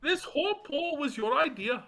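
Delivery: mock Indian accent, shouted. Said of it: "talking in a fucking Indian accent".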